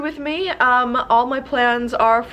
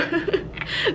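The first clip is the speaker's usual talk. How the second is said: short giggle; 7 semitones higher, 6 dB quieter than usual. Real, not acted. Laughs at her own slip.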